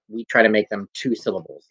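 ordinary talk, nothing marked

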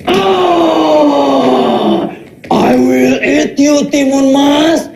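hammed-up ogre roar in a game; play monster. Dad playing ogre for his kids, mock roaring.

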